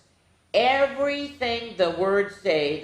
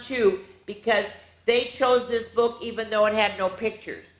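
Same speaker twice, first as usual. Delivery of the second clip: ordinary delivery, nothing marked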